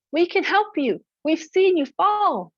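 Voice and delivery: water creatures reassuring her; eager animals. Acted. Water beings calling to Sky Woman, high eager voices.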